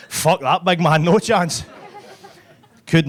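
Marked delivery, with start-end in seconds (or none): 0.1-1.6 loud, high comic rat voice; fleeing rats. Retold or imitated. Rats passing hotel guest on way out, loud refusal. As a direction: refuse in a raised, emphatic voice. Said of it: "the rats were passing me in the way out going".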